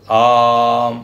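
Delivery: आ held extra long; pluta vowel. Shown as a demonstration. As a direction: prolong आ as pluta. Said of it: "प्लुत". (आ को प्लुत, बहुत लंबा)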